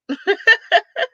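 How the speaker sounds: high laugh; quick high laugh. Real, not acted. Streamer laughing about a viewer's Cash App gift.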